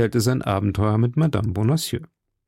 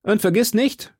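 raised, higher-pitched call; calling after someone; 11 semitones higher than usual. A narrator's reading. D'Artagnan to the departing innkeeper, calling after him. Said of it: "rief D'Artagnan ihm nach".

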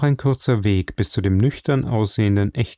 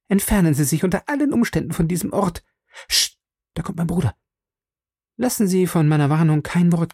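higher, quick, pressing warning voice; young woman, urgent; 7 semitones higher than usual. A narrator's reading. Beryl urging the visitor to leave the moor, high and hurried. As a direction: voice Beryl anxious and hurried, then falsely polite.

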